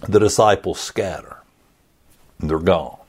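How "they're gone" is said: normal talking voice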